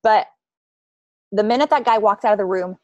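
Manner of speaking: ordinary delivery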